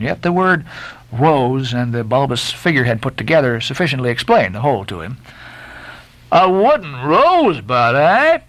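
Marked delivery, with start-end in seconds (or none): 6.3-8.4 high, loud sailor's cry with a pinched nose; Stubb crying out through a held nose. A narrator's reading. Sailor Stubb, nose held, crying out to himself. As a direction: call it out while holding your nose. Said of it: "he cried with his hand to his nose".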